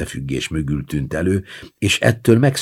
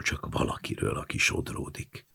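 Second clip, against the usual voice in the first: softer unvoiced whisper; 2 semitones lower, 12 dB quieter than usual. A narrator's reading. Child whispers to grandma about drifting, whispered. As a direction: breathe it out in a whisper. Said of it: "Suttogtam én is".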